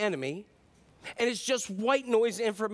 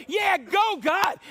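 higher, louder cheering; excited cheer; 9 semitones higher, 6 dB louder, much slower than usual. Retold or imitated. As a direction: cheer God on. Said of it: "they're gonna go".

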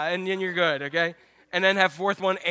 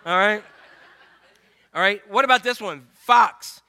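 ordinary delivery

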